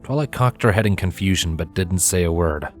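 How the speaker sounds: usual speaking voice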